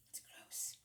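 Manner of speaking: whispered aside. Real, not acted. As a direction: hush it to a whisper. Aside while brushing, grossed out.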